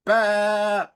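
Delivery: loud, high ram bleat; Alec Baldwin's ram voice. Retold or imitated. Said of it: "Alec Baldwin's ram voice".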